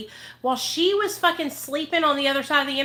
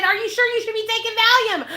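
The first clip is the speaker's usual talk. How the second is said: incredulous repeat; 10 semitones higher than usual. Retold or imitated. Retelling what made the friend angry, high incredulous emphasis. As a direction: say it like you can't believe this caused anger. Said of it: "She was pissed because I said".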